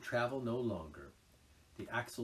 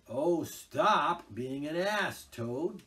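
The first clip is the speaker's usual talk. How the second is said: despairing; 7 semitones higher, 11 dB louder, much slower than usual. A narrator's reading. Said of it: "said Mole despairingly".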